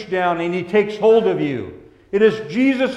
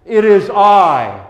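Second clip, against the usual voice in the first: Jesus speaking; 3 semitones higher, 7 dB louder, slower than usual. Acted. Preacher voices Jesus' words.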